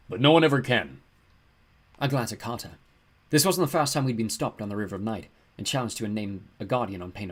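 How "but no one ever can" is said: loud, lamenting ram-god voice; sighing giant. A narrator's reading. Ram giant laments his test, sighing. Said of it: "he sighed".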